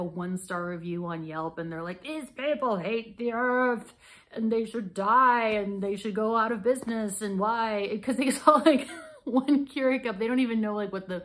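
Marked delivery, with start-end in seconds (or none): 2.0-10.2 loud, slow, overblown indignant rant; hammed-up righteous fury, raised voice; mock outrage. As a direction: imitate an outraged reviewer, stretching the words. Imitates outraged online reviewer.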